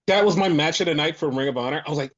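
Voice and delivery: ordinary talk, nothing marked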